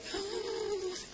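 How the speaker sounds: sharp gasp sucked in to show tension; tense gasp. Shown as a demonstration. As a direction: gasp as if suddenly keyed up. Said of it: "like this, you see".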